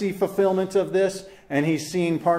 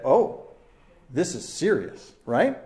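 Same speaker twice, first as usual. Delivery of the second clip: ordinary talk, nothing marked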